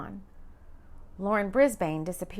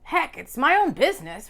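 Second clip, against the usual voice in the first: man voice; 5 semitones higher, 7 dB louder than usual. Acted. Rowan voiced, idea forming.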